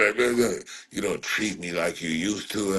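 ordinary talk, nothing marked

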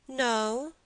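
put-on high, childlike answer; little boy. A narrator's reading. Boy answers the gentleman, high childish voice.

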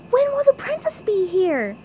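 high, eager small voice; hushed question in a high cartoon voice; whispered question. A narrator's reading. SpongeBob asking the truck driver, secretive high-voiced question. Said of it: "he whispered".

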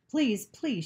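high-pitched inward plea; imploring. A narrator's reading. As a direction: beg urgently, as if in thought. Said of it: "she implored in her mind".

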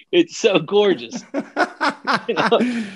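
slow, high, laughing through the words. Real, not acted. Comic cracking up at his own Kentucky joke, laughing. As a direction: let real laughter stretch the words out.

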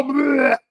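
loud fake retch; short mock throw-up noise; mock vomiting. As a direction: gag sound of disgust. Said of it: "throwing up".

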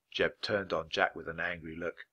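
ordinary delivery

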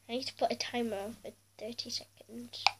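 whispered aside to herself; under her breath, setting the timer; whispering. Real, not acted.

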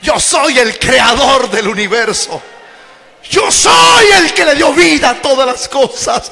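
rising from solemn to booming; building divine proclamation. Acted. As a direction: proclaim it bigger each time, as the Lord himself. Preacher voicing Christ, building up. (proclamación divina que crece hasta el grito)

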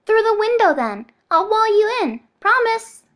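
put-on high, loud childish coaxing; little girl voice. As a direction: call him along like a child. Bonesaw coaxing the clone.

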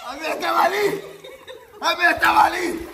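loud, high, laughing accusation; laughing shout. Real, not acted. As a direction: yell it through laughter. Insists about the sock.

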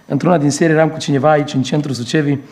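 ordinary delivery

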